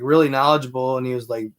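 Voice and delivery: ordinary talk, nothing marked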